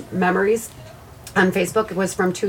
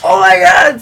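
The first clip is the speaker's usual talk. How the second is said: exasperated mock shout; 8 semitones higher, 11 dB louder than usual. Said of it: "I was like".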